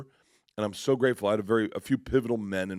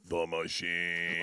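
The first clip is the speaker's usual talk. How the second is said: slow ominous reveal; 3 semitones lower, 3 dB quieter, much slower than usual. Retold or imitated. Interviewer reveals lie detector.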